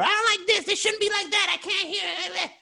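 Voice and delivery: high squeaky mocking voice of a complainer; mock whiny viewer. Host mocking a picky chat viewer, squeaky whine. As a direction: whine it like a fussy critic.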